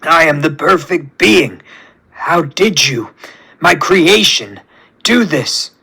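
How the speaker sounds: weakened, slowing villain; halting, spent disbelief; last remaining energy. A narrator's reading. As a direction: strain out words as a beaten tyrant. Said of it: "screams with his last remaining amount of energy".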